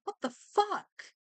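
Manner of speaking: ordinary talk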